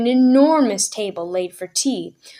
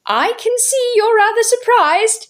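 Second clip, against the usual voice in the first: put-on much higher, sheepish old man; apologetic uncle; 13 semitones higher, 4 dB louder than usual. A narrator's reading. Uncle apologizing to his niece, sheepish and high. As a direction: plead sorry like a jolly uncle.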